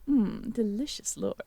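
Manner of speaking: ordinary delivery, nothing marked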